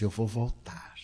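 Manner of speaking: whispered farewell with no voice; the dying mother's last whispered promise. Retold or imitated. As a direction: breathe the words out like a dying woman. Dying mother speaks last words.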